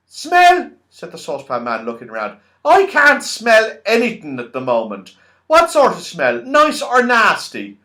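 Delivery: high, loud puzzled voice; deaf Saucepan Man. A narrator's reading. Deaf Saucepan Man mishearing Franny, high and loud. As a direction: mishear and sound baffled.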